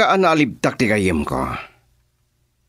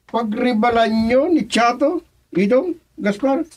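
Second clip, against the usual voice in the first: amused surprise; 11 semitones higher than usual. Acted. Boss questions the teasing men.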